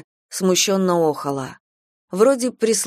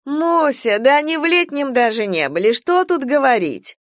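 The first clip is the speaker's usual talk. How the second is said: higher-pitched exclamation to Мося; boisterous chiming-in; 6 semitones higher than usual. A narrator's reading. One relative to Мося at a noisy supper, high animated exclaiming. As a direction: chime in over the table noise. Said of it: "расшумелись".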